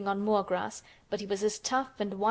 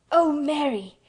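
high, loud, overcome outcry; half-sobbing cry; 6 semitones higher, 7 dB louder, slower than usual. A narrator's reading. Colin overcome at Mary's news, crying out half sobbing. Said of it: "cried out with half a sob".

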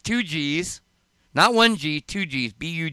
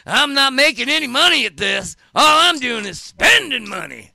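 loud joking rant; 9 semitones higher, 5 dB louder than usual. Real, not acted. Joking rant about spending money.

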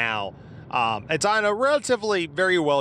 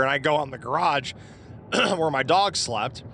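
ordinary talk

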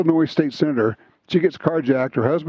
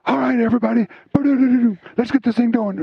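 lively mock hype-up, raised pitch; bursting in like a showman; imitating Ty's loud start; 9 semitones higher, faster than usual. Retelling how Ty fired up the crew, mock excited. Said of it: "Ty goes".

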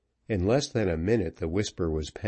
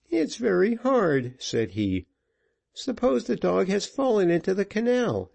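put-on whining boy; 9 semitones higher than usual. A narrator's reading. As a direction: put on a tearful plea. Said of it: "made up a lachrymal face".

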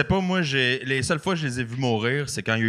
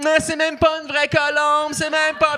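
put-on high, slow whiny child voice; bratty kids; 3 dB louder, slower than usual. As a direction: mimic arguing children. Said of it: "T'as les enfants qui m'ostinent".

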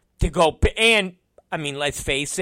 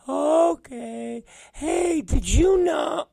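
high mocking falsetto; Will; 12 semitones higher, much slower than usual. Coworker quizzing his boss, high mocking falsetto. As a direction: pester in a squeaky, eager mimic voice. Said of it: "and he's like".

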